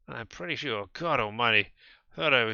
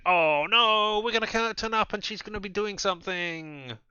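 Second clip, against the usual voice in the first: high, loud mock panic; mock alarm; 9 semitones higher, 6 dB louder than usual. Narrator joking about arriving early. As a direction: gasp it like caught out. Teasing worried aside.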